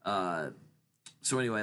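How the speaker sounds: normal talking voice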